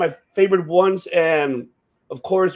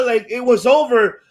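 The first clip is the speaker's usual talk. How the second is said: ordinary talk, nothing marked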